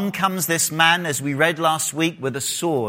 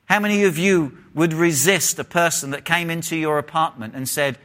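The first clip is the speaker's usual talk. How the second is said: usual speaking voice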